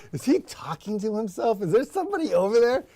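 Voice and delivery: high-pitched voice of baffled viewers; puzzled onlookers. Acted. Host voicing viewers who doubt his company, mock-puzzled. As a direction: imitate viewers wondering, pitched well up. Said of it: "They're probably like".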